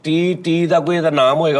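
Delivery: ordinary talk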